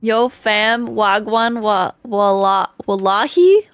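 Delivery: hammed-up Toronto roadman slang. Shown as a demonstration. Streamer reading chat's slang on request, mock Toronto roadman accent. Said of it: "Why would you make me say that?".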